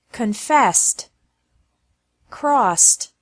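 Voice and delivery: isolated drill words, "missed", "passed" each closed with an S plus T. Shown as a demonstration. Teacher drilling verbs ending with an S sound, ED said as T in "crossed", "guessed". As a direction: say "Confessed", "dressed", "promised" ending in ST, no extra syllable. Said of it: "E D is also pronounced like T".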